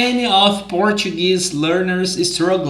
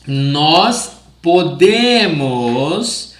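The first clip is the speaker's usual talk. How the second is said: slow and deliberate pronunciation; slow clear drill; much slower than usual. Shown as a demonstration. Portuguese teacher drilling conjugations slowly. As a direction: spell it out in sound for learners. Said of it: "slow teaching demo".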